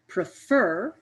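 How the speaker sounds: Prefer with the second syllable "fer" stressed; stress on last syllable. Shown as a demonstration. Teacher showing where the stress falls in prefer, stressed on the last syllable. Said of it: "more energy or sound into the last part of prefer".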